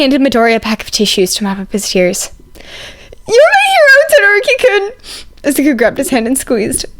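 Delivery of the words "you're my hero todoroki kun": high, loud, sobbing gratitude; tearful cry of joy. A narrator's reading. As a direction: wail it out through happy tears. Izuku bursting into tears.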